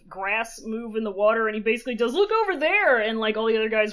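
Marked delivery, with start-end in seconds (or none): 2.1-3.0 high alarmed shout; calling out. Retold or imitated. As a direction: point and call to the guards. Guard calls to others.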